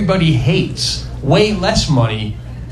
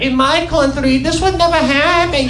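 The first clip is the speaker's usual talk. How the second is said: high-pitched nagging mimicry; mock a smug foreign wife, pitched up; chirping foreign wife; 7 semitones higher than usual. Foreign wife chirping in his ear about America, mocking and high.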